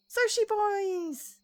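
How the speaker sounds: high, slow, stretched-out delighted cry; excited cheer. Acted. Character spotting the sushi shop, a drawn-out happy cheer. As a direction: greet the sushi like long-lost friends.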